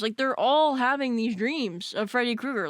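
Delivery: ordinary talk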